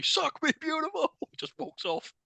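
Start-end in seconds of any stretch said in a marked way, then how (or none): 0.0-1.1 high, sing-out teasing imitation; hammed-up high mock seduction; mocking romantic line. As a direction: mimic the chat-up line mockingly.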